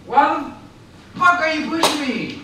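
furious, why are you pushing; angry shout. Real, not acted. Drunk man yelling at friend.

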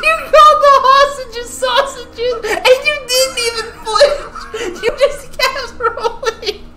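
crying with laughter; laughing hard. Real, not acted. Laughing hard about sausages slip.

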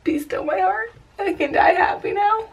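high, soft, overcome with happiness; tender bliss. Real, not acted. Mother could die happy, softly blissful. Mother swooning over her baby.

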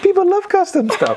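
squeaky high-pitched laughing voice. Real, not acted. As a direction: let the voice shoot way up. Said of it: "My voice went really high pitched then".

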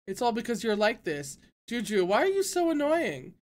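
building from plain to a high, loud complaint; agitated outburst. A narrator's reading. Nephew yelling at his uncle, agitated. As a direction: lash out at him.

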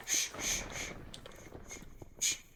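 soft unvoiced shush. Real, not acted. Gamer sneaking up, soft unvoiced shushing. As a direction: hush them, breath only.

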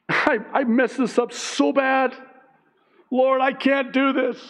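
high-pitched pleading wail to God; hammed-up admission of defeat; acted despair. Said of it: "you throw up your hands".

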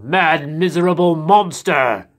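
slow booming giant snarl; menacing giant. A narrator's reading. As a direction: growl it slowly.

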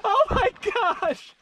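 squealing giggles, oh my gosh; high nervous laughter. Real, not acted. Nervous laughter on steep climb.

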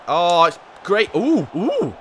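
excited near miss. Real, not acted. Manager watching a chance fly by, excited oohs. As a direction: ride the chance with rising excitement.

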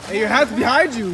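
shouting across the water; calling out loud. Real, not acted. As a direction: yell it so he hears. Calling louder to man.

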